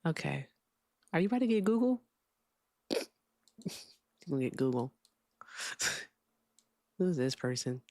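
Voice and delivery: ordinary talk, nothing marked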